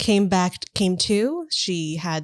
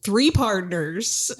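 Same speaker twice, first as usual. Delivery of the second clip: put-on Western accent. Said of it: "That sounds more like a cowboy".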